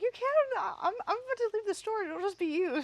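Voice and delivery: high, loud, rushed retelling; mock panicked protest. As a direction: blurt it out in hammed-up alarm. Acts out her flustered reply.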